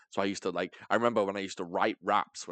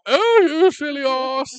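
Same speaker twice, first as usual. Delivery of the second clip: very high, loud, drawn-out English caricature; mock British accent; 12 dB louder, slower than usual. Showing the other accent, wildly hammed-up British. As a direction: hoot it as an over-the-top Englishman. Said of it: "that kind of accent".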